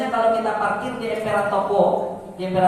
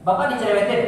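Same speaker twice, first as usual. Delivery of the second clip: normal talking voice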